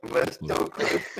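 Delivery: distorted, crackling microphone sound; crispy mic. Real, not acted. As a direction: voice breaking up through a bad mic. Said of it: "a little extra crispy right now".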